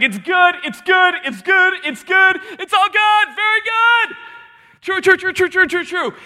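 high, loud, rapid-fire exclamations; excited God. Acted. God approving what was made, excited high exclaiming. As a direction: pile up each word in a high, delighted shout. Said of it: "God's like".

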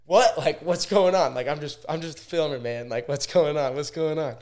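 loud, high retold outburst; startled protest. Filmer protesting to the cop choking him out, loud and startled.

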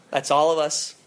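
normal talking voice

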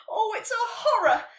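high, loud dismay; horrified thought. A narrator's reading. Nelly realising her letter is unwritten, horrified. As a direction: cry it out in dismay. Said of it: "she thought".

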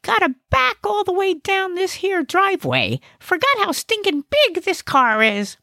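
very high, grouchy comic voice; grumbling Gnesi. Acted. Gnesi grumbles while backing out the car, high and grouchy. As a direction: grouse in a high-pitched character voice.

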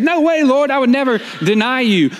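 high-pitched, insistent denial; vehement protest. Acted. Peter protests he would never deny.

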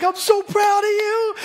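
father praising his little son, slow and tender; proud dad. Retold or imitated. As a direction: gently build him up.

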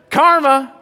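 high, loud smug karma; smug gloat. Retold or imitated. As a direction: savor it. Punchline of gloating impression.

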